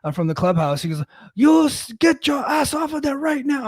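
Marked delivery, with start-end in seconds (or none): 1.3-3.6 high-pitched, irate yelled order; retold yell, pitched way up; angry old Korean man yelling. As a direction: imitate an angry old man chasing someone off. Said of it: "he's, like, yelling and cussing at me".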